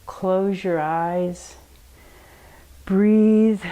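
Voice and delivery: put-on gentle bedtime affirmations for children; guided meditation. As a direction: lull them into rest. Leads a relaxation meditation.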